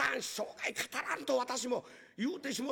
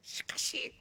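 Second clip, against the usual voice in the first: hushed, unvoiced gossip; two servants gossiping behind the master's back in a whisper; 6 dB quieter, much slower than usual. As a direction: whisper so the master won't hear.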